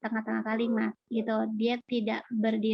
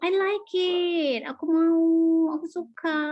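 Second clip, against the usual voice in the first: eager liking; 6 semitones higher, 5 dB louder than usual. Acted. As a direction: coo it like you crave it. Acts out liking, wanting something.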